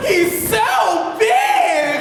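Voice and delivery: high, slow falsetto squeal; mock swoon. Onlooker reacting to the hero's arrival, mock swooning squeal. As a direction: stretch it out in hammed-up awe.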